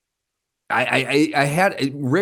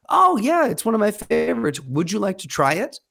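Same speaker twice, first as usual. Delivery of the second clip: high-pitched imitation of a young woman; friendly young woman; 8 semitones higher than usual. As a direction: lightly mimic her chirpy, much higher offer. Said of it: "the young woman goes".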